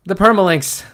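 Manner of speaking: loud, high outburst of dismay; yelled in alarm; exasperated shout. Real, not acted. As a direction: cry it out as if disaster has struck. Repeated outburst over permalinks.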